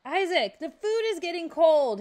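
high, loud call downstairs. A narrator's reading. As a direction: shout up the stairs. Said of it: "his mom screamed from below".

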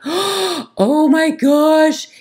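gasp, then drag it out in horror; dismayed gasp. Retold or imitated. Podcaster hearing loud drilling before interviews, gasping dismay. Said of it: "And I'm like".

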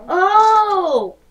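gasp, then a loud high "oh"; startled gasp and oh. Real, not acted. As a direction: cry out in surprise. Startled during the game.

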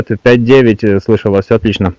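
ordinary talk, nothing marked

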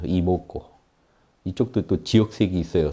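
usual speaking voice

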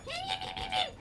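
high squeaky bat screech. Retold or imitated. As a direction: imitate a bat's shrill squeak. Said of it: "the bats were always like".

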